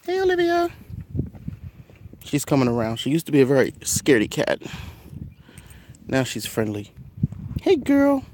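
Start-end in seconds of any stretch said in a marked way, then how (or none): 0.0-0.7 high sing-song call to a cat. Real, not acted. Calling his cat Olivia in a high coo. 7.6-8.2 high sing-song call to a cat. Real, not acted. Calling his cat Olivia in a high coo.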